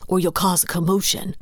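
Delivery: ordinary delivery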